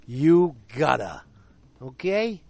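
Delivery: slow, loud, emphatic telling-off; mock scolding. Recapper as husband scolding his wife, slow mock sternness. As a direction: play a husband laying down the law.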